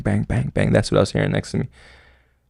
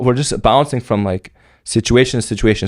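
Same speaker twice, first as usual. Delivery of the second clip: ordinary talk, nothing marked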